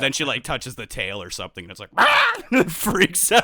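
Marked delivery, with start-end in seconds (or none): 2.0-2.3 very high, loud screech; piercing mock scream; cat freak-out. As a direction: imitate a cat's sudden shriek.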